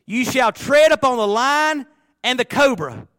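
loud, slow, high scripture proclamation; preaching at full force. Acted. Preacher proclaiming a psalm verse to the congregation, booming. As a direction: thunder the verse out to the church.

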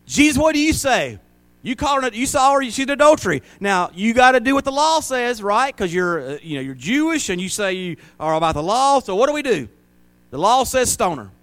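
high, loud, mocking demand; challenging crowd. Accusers taunting Jesus about the law, high and loud.

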